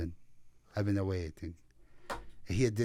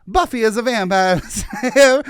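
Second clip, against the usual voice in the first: put-on laughing, breaking accent; 8 semitones higher, 10 dB louder than usual. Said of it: "I can't do it in the Italian accent".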